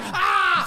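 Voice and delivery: high mock crowd scream; hammed-up high-pitched "Ah!"; celebrating yell. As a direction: yell like a rowdy fan at a touchdown. Acting out jerk celebrating touchdown.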